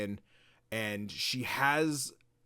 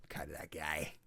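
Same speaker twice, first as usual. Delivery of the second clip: whispered, much softer smug brag; 8 semitones lower, 12 dB quieter than usual. Retold or imitated. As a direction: murmur it like a cocky secret. Bragging aside, whispered.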